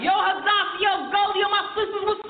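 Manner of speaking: high, sustained street call; mock street greeting, called out high. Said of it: "How's that gonna sound?".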